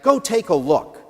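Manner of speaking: ordinary talk, nothing marked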